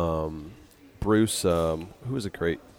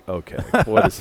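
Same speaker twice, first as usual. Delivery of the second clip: much higher, louder startled disbelief; incredulous; 12 semitones higher, 5 dB louder than usual. Retold or imitated. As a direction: react like you can't believe your luck. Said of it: "Raymond's like".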